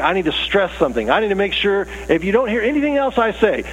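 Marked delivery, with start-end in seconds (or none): 2.1-3.6 high, urgent warning; stressed, insistent parental warning; emphatic parent. Acted. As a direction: make sure they hear this. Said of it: "Or leaders are like".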